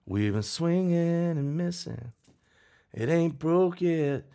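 slowed, lifted voice for Heath's line; quoting Heath. Retold or imitated. Heath quoted, retelling songwriting moment.

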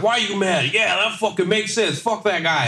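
high-pitched egging on an angry client; riled-up mock outrage; hyping up. Hyping up mad client, retold.

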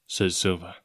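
ordinary delivery, nothing marked

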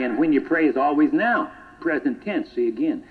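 ordinary talk

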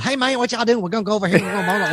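high-pitched Tennessee mountain twang; Tennessee mountain accent. Retold or imitated. Showing how Tennessee mountain people talk, high-pitched mountain accent. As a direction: imitate mountain folk with a Southern twang. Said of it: "mountain people have that".

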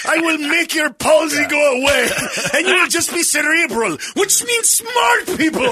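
high, hammed-up healing proclamation; mock miracle healer. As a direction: pronounce the miracle grandly, pitch climbing. Playing a grandiose healer to followers.

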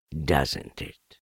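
low donkey voice, lightly pleased; Eeyore, pleased. A narrator's reading. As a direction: ask low, quietly content. Eeyore delighted with the pot.

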